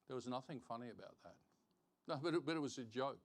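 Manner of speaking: ordinary talk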